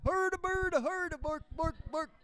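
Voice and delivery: high, squeaky Muppet babble; mock Muppet voice; Muppet voice to cop. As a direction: answer the officer like a Muppet. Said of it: "Muppet voices".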